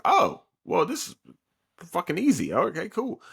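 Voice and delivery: high, breezy and cocky; smug dismissal. Retold or imitated. Korosuchi mocking how easy Quincy powers are, high and smug. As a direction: shrug it off as too easy.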